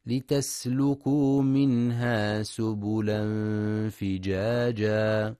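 Quran recitation. Acted. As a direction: chant the verse with long held vowels.

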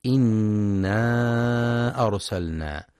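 إنا أرسلنا with the final a of إنا held four to five beats; مد منفصل أربع حركات; long madd. Shown as a demonstration. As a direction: stretch the aa of إنا before أرسلنا. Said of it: "وينتبه للمد المنفصل في إنا أرسلنا".

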